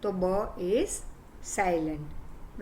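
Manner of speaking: normal talking voice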